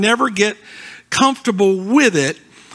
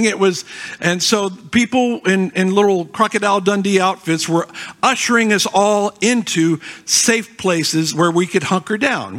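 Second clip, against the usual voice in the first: normal talking voice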